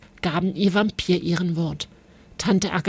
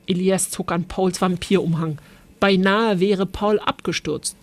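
usual speaking voice

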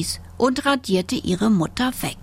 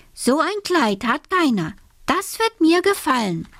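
put-on high, lilting proud girl; girl pleased with her own idea; little girl character; 8 semitones higher than usual. A narrator's reading. Alice tells herself nobody has such a dress, high and proud.

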